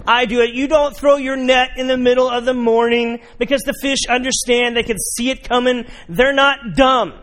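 loud high frustrated argument; exasperated rant. Acted. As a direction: push back hard, fed up. Peter arguing with Jesus.

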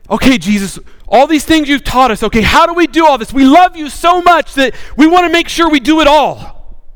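eager disciples. Acted. Disciples asking Jesus how to obey, eager and excited. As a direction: gush it keenly, pitch lifted.

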